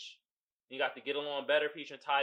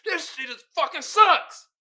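gamer frustration; 10 semitones higher, 9 dB louder than usual. Retold or imitated. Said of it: "and you like".

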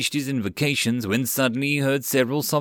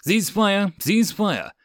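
high-pitched repeated military order; lieutenant calling out over gunfire; yelled command; 10 semitones higher, 3 dB louder, slower than usual. A narrator's reading. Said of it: "Lieutenant Joseph yelled".

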